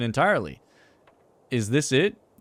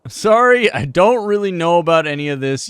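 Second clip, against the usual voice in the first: mock loud apology; 6 semitones higher, 9 dB louder than usual. Player answers character loudly.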